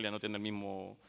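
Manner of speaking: usual speaking voice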